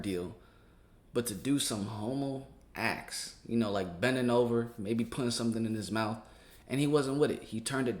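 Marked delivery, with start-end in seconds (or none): none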